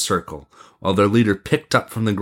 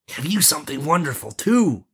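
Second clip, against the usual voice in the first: curious prince; 7 semitones higher than usual. Acted. Prince asking the boys about their marvel, high and curious. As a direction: ask with lifted, interested voice.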